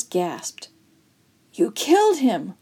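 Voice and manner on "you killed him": ordinary delivery, nothing marked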